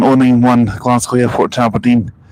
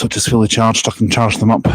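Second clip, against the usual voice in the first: ordinary talk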